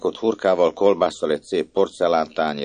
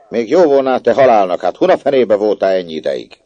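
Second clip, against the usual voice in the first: loud, higher furious telling-off; angry scolding; 6 semitones higher, 9 dB louder than usual. Acted. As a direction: bawl at the late child. Household scolds the child. (dühös szidás)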